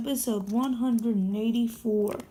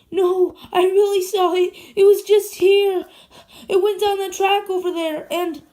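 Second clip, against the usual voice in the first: high, loud, excited insisting; agitated insistence; 8 semitones higher, 9 dB louder than usual. Acted. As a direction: blurt it out, desperate to be believed. Insisting he saw the ghost.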